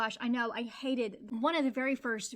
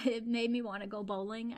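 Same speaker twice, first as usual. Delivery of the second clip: normal talking voice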